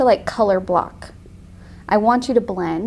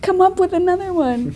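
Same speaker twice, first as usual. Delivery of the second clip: higher-pitched mock child's demand; imitating demanding kids; 9 semitones higher, 4 dB louder than usual. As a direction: mimic kids rejecting a silly voice. Retold kids rejecting dad's voices.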